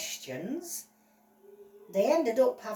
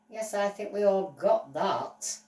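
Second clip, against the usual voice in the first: ordinary talk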